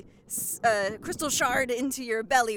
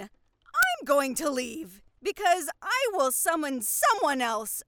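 ordinary delivery

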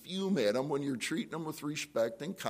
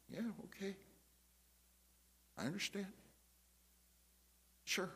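very soft, high, placid voice; soft answer; 6 semitones higher, 13 dB quieter, faster than usual. Shown as a demonstration. Listener answering someone furious and fuming, softly. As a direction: demonstrate a gentle, unruffled reply. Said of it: "you're talking like this".

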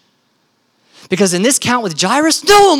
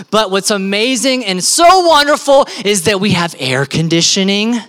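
normal talking voice

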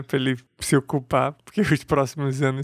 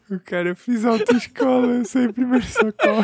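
giggling; 10 semitones higher, 3 dB louder than usual. Real, not acted. Bragging while laughing.

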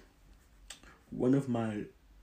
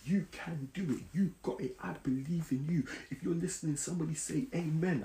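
higher-pitched, rousing preacher; preachy mode; 6 semitones higher, 4 dB louder than usual. Acted. Podcaster slipping into preaching at listeners, preachy mode. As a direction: preach it like you're working a congregation. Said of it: "I start getting into preachy mode".

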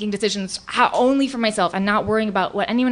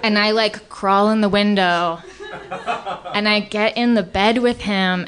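ordinary talk, nothing marked

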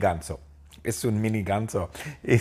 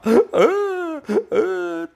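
a very high, loud crying gasp; hammed-up sob gasp; 4 dB louder than usual. Doctor Phil breaking down, loud high mock sob gasp.

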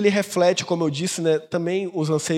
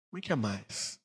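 much softer and lower, almost to itself; hushed echo; 8 semitones lower, 12 dB quieter, much slower than usual. Shown as a demonstration. Pastor quietly repeats that mothers want more, hushed. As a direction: breathe it out as an aside. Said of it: "mas mãe quer mais".

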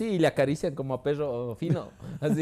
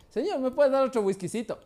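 higher, mock-polite plea; cheeky polite request; 9 semitones higher than usual. Young man asking the father-in-law for more whisky, mock-polite. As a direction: ask sweetly for more whisky.

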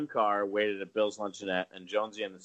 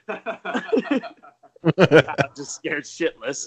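laughing; 5 semitones higher, 5 dB louder, much slower than usual. Real, not acted. Laughing while retelling fear.

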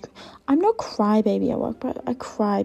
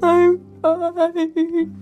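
mock brave face; 7 semitones higher, 5 dB louder than usual. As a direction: gasp then high, loud chirpy reply. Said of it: "Are you okay? I was like".

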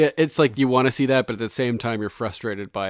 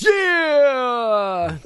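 excited shout; 12 semitones higher, 3 dB louder than usual. Acted. Reading an excited listener comment.

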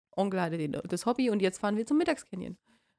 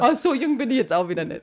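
laughing. Real, not acted. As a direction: giggle through the words. Laughing reply to teasing.

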